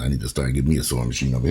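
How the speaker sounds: ordinary talk, nothing marked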